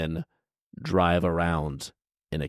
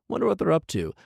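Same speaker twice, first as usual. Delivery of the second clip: high, dopey musing; mock man wondering; 11 semitones higher, much faster than usual. Vlogger mocking the man's late idea, high dopey voice. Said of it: "he says, like".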